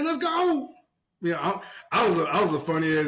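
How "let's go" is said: high challenge. Retold or imitated. Challenging the dude.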